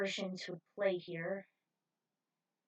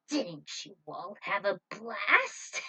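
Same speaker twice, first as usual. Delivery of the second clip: mocking taunt; 6 dB louder, slower than usual. Villain Luna taunting the pilots.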